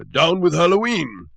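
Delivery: high, loud blustering shout; angry shout. Acted. Butterbean ranting at the town meeting, high loud shout. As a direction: rant at the crowd like a pompous grump. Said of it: "shouted Butterbean".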